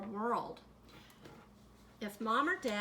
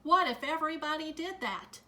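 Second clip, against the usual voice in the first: stern official; 5 semitones higher, 6 dB louder than usual. A narrator's reading. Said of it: "waved his arms and said".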